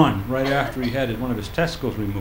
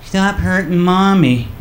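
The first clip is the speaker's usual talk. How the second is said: put-on slow, higher little kid pleading; child's voice; 4 semitones higher, 6 dB louder, slower than usual. Little kid with bat telling daddy off, slow child voice. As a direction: play his small child scolding daddy.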